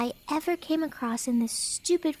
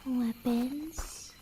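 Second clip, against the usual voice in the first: usual speaking voice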